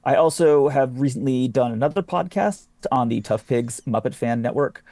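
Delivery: ordinary talk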